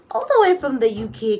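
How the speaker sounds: thrilled show-off; excited brag. Real, not acted. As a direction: burst out with pride. Bragging about gifts from UK.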